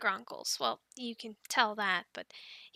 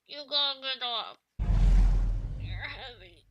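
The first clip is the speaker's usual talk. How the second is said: strained under weight; 5 dB louder than usual. Acted. Handler pinned under a heavy young dragon, strained.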